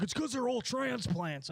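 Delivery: snide know-it-all mimic. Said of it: "Someone's gonna be like".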